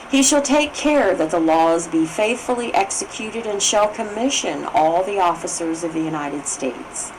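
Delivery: ordinary talk, nothing marked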